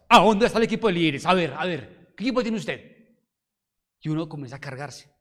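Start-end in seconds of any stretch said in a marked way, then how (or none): 0.1-2.9 a hint of an indignant fault-finder, loud and fast; mock blamer. Pastor mimics a critic demanding the leaders team. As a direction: demand answers like a nosy critic. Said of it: "¿qué dicen los que les gusta buscar culpables?".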